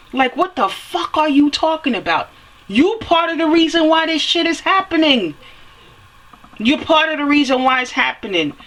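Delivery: rising indignation, pitch up; heated scolding. Real, not acted. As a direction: voice climbs high in indignant rant. Scolding the industry and listeners.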